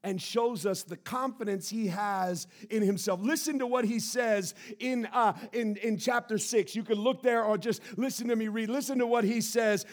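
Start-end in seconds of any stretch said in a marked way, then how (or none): none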